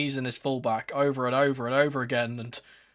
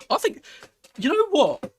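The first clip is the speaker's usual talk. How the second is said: excited; 13 semitones higher, 5 dB louder than usual. Real, not acted. Excited fan prediction.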